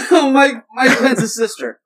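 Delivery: cracking up mid-sentence; laughing, high and loud. Real, not acted. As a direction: squeal it out through laughter. Laughing fit after a hit.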